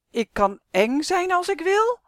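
threat rising high and loud; menacing coat. Acted. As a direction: build the threat up in pitch and volume. Coat threatens boy, building up. (dreigend, steeds hoger en luider)

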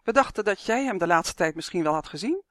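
ordinary delivery

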